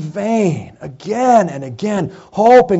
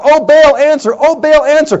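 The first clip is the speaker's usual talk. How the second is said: high, loud repeated plea; calling out; 8 semitones higher, 5 dB louder than usual. Acted. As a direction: keep crying out to a god. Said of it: "people calling out".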